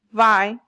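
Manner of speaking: "Vi-" alone, punched loud; stressed syllable. Shown as a demonstration. Teacher isolating the stress for class, loud "Vi-". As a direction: hit "Vi-" hard as the stressed beat. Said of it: "you hear a little stress in my sounds".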